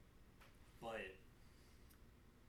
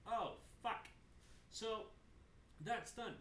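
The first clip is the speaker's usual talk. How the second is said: ordinary talk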